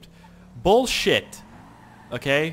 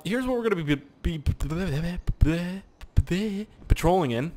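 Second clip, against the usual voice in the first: Timmy stutter; 5 semitones higher than usual. Retold or imitated. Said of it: "I turned into, uh, Timmy there, or Jimmy".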